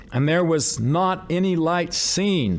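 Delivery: slow, stressed not any light; emphasized. Shown as a demonstration. Preacher counting how often light is denied, emphatic. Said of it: "That's three times".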